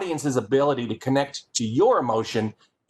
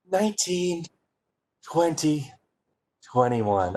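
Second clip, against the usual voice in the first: in love; slower than usual. Shown as a demonstration. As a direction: count dreamily as if in love. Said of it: "twenty-one in love".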